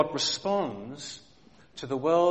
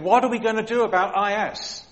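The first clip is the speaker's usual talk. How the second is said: louder, higher, hurried mock fretting; mock panicked God; 6 semitones higher, 7 dB louder than usual. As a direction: ham up a worried God wringing his hands. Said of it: "God up there in heaven wondering".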